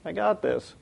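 soft, offhand dismissal; casual, unbothered reassurance; nonchalant Jesus. Acted. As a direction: brush it off softly after a little psh. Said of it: "He's like".

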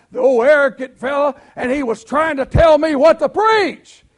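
growing indignant retelling of a bad dream; building retold complaint. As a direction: let the friend's outrage climb to a shout. Retold friend telling bad dream.

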